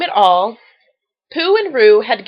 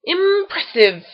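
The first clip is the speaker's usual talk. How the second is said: slow, higher whistled Impressive with a falling end; 6 semitones higher, slower than usual. A narrator's reading. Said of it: "whistled Gopher".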